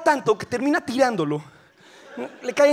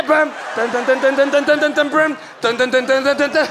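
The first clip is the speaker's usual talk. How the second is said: tan tan repetido rítmico; ritmo de golpes; 4 semitones higher, slower than usual. Acted. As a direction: marca el ritmo como percusión. Imita la comunión rapidísima. (el Papa repartiendo hostias a ritmo)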